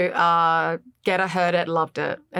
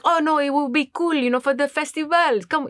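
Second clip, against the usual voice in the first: higher, imitated French accent; put-on Frenchman's reassuring accent; 7 semitones higher than usual. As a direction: coax in French-accented English. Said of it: "I speak a little bit of French".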